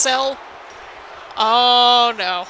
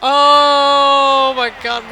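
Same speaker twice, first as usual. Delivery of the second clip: slow awe; 7 dB louder, much slower than usual. Real, not acted. Awed by the ending.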